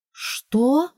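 shocked high what; astonished. Acted. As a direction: gasp it out in disbelief. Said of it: "изумлённо округлила глаза".

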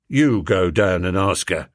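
low, weary constable; london policeman. Acted. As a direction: tell her off like a bored bobby. Policeman tells maid.

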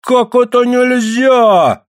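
high, drawn-out outraged shout; Ksenia's yell. A narrator's reading. Ksenia advances on the men, yelling. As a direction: bawl the question slowly and high. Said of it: "заорала Ксения".

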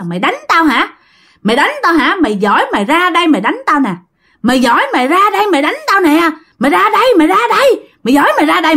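high-pitched, taunting ghost's challenge; acted scolding. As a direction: goad her to come out, high and insistent. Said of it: "bà mới chửi bà nội của bạn".

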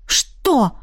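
startled gasp of a question. A narrator's reading. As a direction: gasp it in surprise. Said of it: "ахнула я". (ахнуть от неожиданности)

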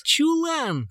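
high, eager outburst; joyful blurt. A narrator's reading. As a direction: blurt it out with joy. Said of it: "радостно выпалил Крит".

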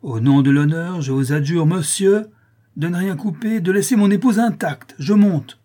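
loud, pompous plea climbing high; frantic husband's shouted entreaty; stentorian appeal. A narrator's reading. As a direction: implore up the stairwell with rising urgency. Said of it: "s'écria-t-il d'une voix de stentor".

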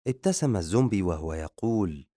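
plain reading voice

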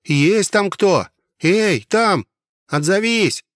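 high, loud call through a door. A narrator's reading. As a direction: shout to find someone behind the door. Stranger calling through the door. (громкий оклик)

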